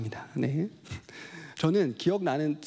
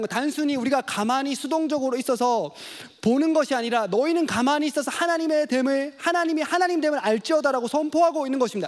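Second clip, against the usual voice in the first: higher, louder sermon proclamation; fiery preaching; 7 semitones higher, 3 dB louder than usual. Acted. Preacher proclaiming the psalm's meaning to the congregation, rising fervent.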